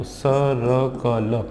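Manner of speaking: each letter sound said slowly; slow phonics blending. Shown as a demonstration. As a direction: blend the sounds one at a time. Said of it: "क्या आ गया आपका?".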